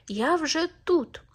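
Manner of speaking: high, slow triumphant call; hedgehog wife calls out. A narrator's reading. Hedgehog's wife calls to the arriving hare, high and slow. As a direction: sing it out as if already won. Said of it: "а тут їжачиха йому й крикнула".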